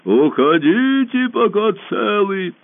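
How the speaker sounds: very slow, loud booming command; muffled monster voice. A narrator's reading. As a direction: boom it out over the forest. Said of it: "глухой голос".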